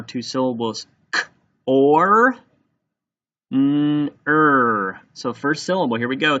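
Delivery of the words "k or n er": "K, or, n, er" with gaps between sounds; sounded out. Shown as a demonstration. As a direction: stretch and separate "K, or, n, er". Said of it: "Let's tap out corner".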